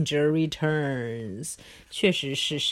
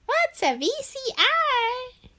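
put-on high kid voice. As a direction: ask like a clueless kid.

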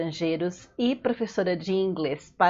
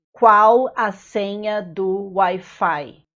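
slow, careful and clear; slow model phrase; 3 dB louder than usual. Shown as a demonstration. Teacher to foreign learners, slow careful model phrase. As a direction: spell it out slowly for the learner. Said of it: "teacher models phrase for learners".

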